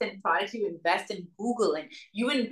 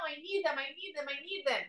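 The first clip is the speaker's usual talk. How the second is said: soft, urgent pleading, faster at the end; pleading king; 6 semitones higher, 9 dB quieter, faster than usual. Retold or imitated. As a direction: beg softly, hiding in the cave.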